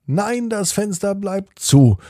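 put-on firm, high-pitched child's no; firm refusal. A narrator's reading. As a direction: put your foot down as the child. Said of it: "bestimmt Felix".

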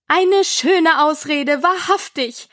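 high, drawn-out scorn; slow, scornful dismissal; deep contempt. A narrator's reading. Pigeon dismisses Alice's excuse, contemptuous. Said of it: "im Tone tiefster Verachtung".

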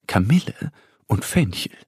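usual speaking voice

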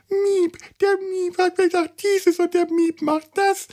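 very high squeaky little creature voice; tiny cute voice. Acted. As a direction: squeak it like a fluffy alien pet. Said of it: "einer putzigen kleinen Stimme".

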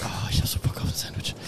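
hungry whisper. Acted. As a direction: whisper your craving. Whispered craving. (hungriges Flüstern)

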